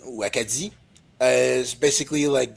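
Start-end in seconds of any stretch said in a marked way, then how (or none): 0.1-0.7 Acadie said the French way, stress on the last syllable; French pronunciation. Shown as a demonstration.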